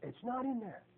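very soft retold reply; small, quiet voice of baffled surprise; hushed disbelief. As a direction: imitate a stumped man, barely voiced. Host retold, second missing verse.

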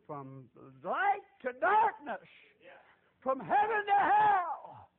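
loud, high and slow, each word stretched; slow preaching shout. Real, not acted. Preacher to his flock on his own conversion, slow loud proclaiming. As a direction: proclaim it like a revival climax.

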